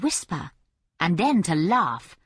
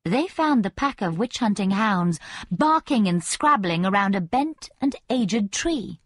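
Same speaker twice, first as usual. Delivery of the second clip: ordinary delivery, nothing marked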